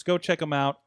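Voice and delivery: ordinary talk, nothing marked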